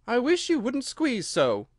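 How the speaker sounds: high, slow complaint; complaining Dormouse. A narrator's reading. Dormouse squashed beside growing Alice, high drawn-out complaining. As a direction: grumble in a high character voice.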